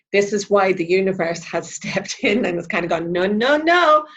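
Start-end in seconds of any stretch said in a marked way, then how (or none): 3.1-4.1 rising, louder string of noes; emphatic refusal. Acted. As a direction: put a stop to it, voice climbing high. Universe steps in to stop us.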